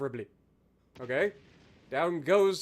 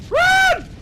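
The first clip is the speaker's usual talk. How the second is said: high, loud yell to flee; sudden high-pitched battle yell; panicked shout; 6 dB louder than usual. Acted. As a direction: scream the order at the top of your voice. Shouted alarm during the game.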